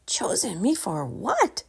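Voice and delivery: put-on high, puzzled young voice; a boy asking, confused; boy wondering. A narrator's reading. Young Drake wondering aloud to the soldier. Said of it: "Drake wondered".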